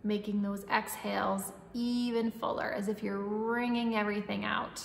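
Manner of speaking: calm, even breathing-coach voice; guided meditation. Acted. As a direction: guide the breath gently and steadily.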